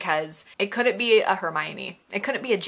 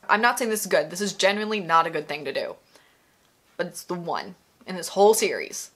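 normal talking voice

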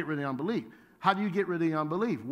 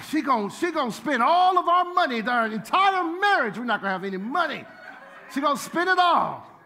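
louder, higher, half-laughing complaint; hammed-up angry panic; 6 semitones higher, 5 dB louder than usual. Newlywed fretting that his wife will spend all their money, mock angry panic. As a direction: rant like a panicked new husband, laughing at it. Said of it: "And I was angry".

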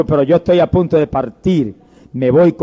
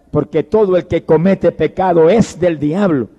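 normal talking voice

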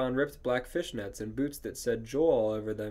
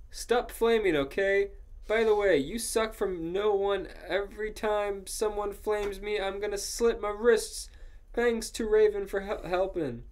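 higher, whiny note-writer voice; petulant author; 8 semitones higher than usual. Acted. Author scolding people who flame her, higher petulant voice. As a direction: read it as a touchy teenage author.

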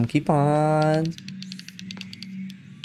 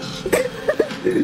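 high squealing laugh; shrieking laugh. Real, not acted. Laughs after close call.

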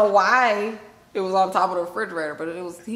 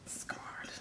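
whisper; 20 dB quieter than usual. Acted.

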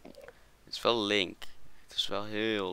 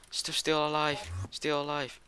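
high little survivor's cheer; high-pitched relief; 7 semitones higher, slower than usual. Real, not acted. Relieved after surviving attack. (hoog en opgelucht)

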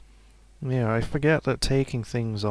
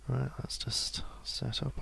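soft whispered self-talk; half-whispered, to himself; 8 dB quieter than usual. Real, not acted. Muttering while setting up in game.

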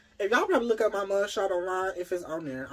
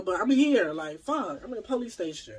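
normal talking voice

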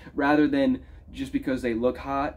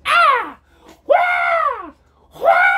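very high, loud shouted grunts of effort; hammed-up screeching tennis yells; aggressive tennis grunts; 9 dB louder, much slower than usual. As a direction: imitate a player screaming on each hit. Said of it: "she's yelling with aggression".

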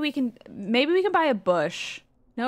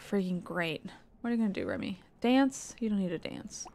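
ordinary talk, nothing marked

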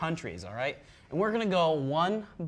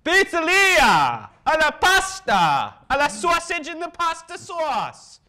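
very loud, high, drawn-out Italian caricature; yelled mock Italian; 11 semitones higher, 9 dB louder, slower than usual. Host copying the guest's lesson, yelled mock Italian. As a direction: bellow it like a hammy Italian. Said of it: "your turn".

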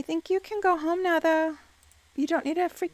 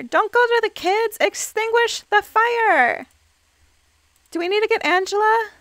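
frantic louder pleading; alarmed shouting; 7 semitones higher, 6 dB louder than usual. Real, not acted. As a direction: shout orders at useless sims. Fire spreading, sims ignore it.

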